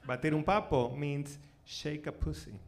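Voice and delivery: ordinary delivery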